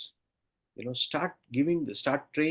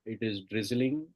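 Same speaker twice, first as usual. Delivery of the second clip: soft spa whisper; 4 dB quieter than usual. Acted. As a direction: lull them down with each count. Guided meditation countdown.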